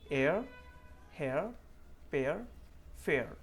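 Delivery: "एयर, हेयर, पेयर, फेयर" with a clean gliding ea-ending, no hard r; correct pronunciation. Shown as a demonstration. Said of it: "correctly कैसे pronounce करना है". (साफ़ उच्चारण, बिना र के)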